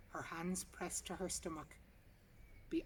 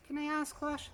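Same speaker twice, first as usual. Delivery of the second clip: higher polite receptionist query; 10 semitones higher, 7 dB louder than usual. A narrator's reading. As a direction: ask like a cautious receptionist. Receptionist asks.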